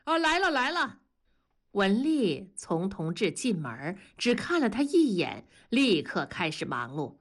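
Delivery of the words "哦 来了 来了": high, placating call back; hurried answer. A narrator's reading. Dad answers impatient daughter upstairs, hurried call back. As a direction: answer hastily from a distance, pitch raised. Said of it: "同志赶紧回答".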